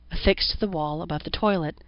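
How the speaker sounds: ordinary delivery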